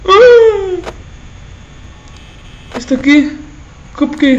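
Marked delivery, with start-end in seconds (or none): 0.1-0.9 sudden high startled cry; startled yelp. Real, not acted. Player spots the animatronic on camera, startled yelp. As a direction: jolt out a short scared yelp. Jump scare while watching cameras.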